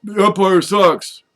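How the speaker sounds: loud high mock heckle; fan yelling. Imitating football fans, he yells a loud heckle. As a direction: bellow at the ref like a fan in the stands. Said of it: "what do they say when they go to football".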